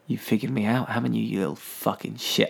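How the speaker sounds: low, quiet mock menace; hushed villain confrontation, retold; menacing villain. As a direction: play a softly threatening killer. Villain confronts hero in retelling.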